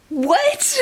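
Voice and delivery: high, stunned what; real shocked what. Real, not acted. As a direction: blurt it out in disbelief. Shocked reaction to the line.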